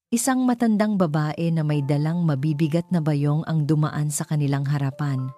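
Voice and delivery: ordinary delivery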